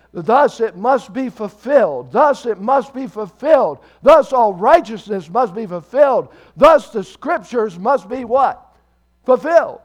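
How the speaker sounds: loud, high, rhythmic pulpit repetition; emphatic preaching. Real, not acted. Preacher quoting Jesus on fulfilled scripture, emphatic preaching. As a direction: hammer each repeated phrase home.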